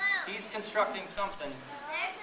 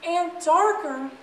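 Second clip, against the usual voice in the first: small mouse voice; 12 semitones higher, 6 dB louder, slower than usual. Acted. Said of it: "And Lily says".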